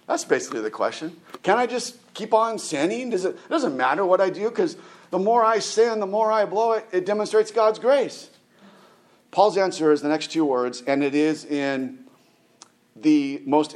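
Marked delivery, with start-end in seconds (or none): 1.4-8.3 higher-pitched, eager excuse-making; mock self-justifying. Preacher voicing a sinner's excuse, mock-eager and high. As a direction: argue it like a cheeky objector, pitched up.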